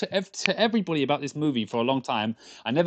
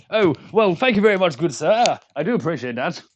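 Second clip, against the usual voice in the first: loud, much higher mock-posh gentleman; posh smart voice; 6 semitones higher, 9 dB louder than usual. Streamer thanks US viewer in a hammed-up posh voice. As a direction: put on a plummy grand voice to sound clever. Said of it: "if I have to sound smart".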